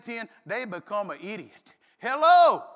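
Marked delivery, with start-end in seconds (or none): 2.0-2.6 sudden loud sarcastic hello; mock loud hello. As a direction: bark a sarcastic hello at the driver. Mock greeting to bad drivers.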